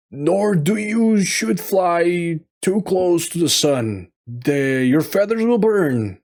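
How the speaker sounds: lightly voiced dad pleading with his son; fatherly warning. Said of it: "Icarus' father said to his son".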